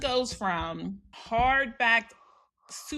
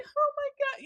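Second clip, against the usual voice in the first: surprised. Retold or imitated. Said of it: "Whitney was like".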